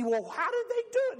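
very high, fast put-on voice; Lena's voice. Lena pressing Ole, very high and fast. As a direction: rattle off the question. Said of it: "and she".

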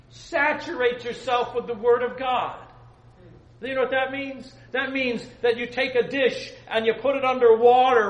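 loud, high preaching; fiery preaching. Acted. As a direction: preach it with full force. Preaching at full force.

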